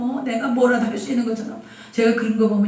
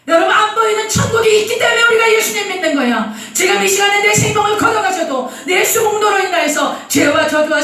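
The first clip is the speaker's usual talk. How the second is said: fervent preaching; 6 semitones higher than usual. Acted. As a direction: preach it with full conviction. Preaching at full force.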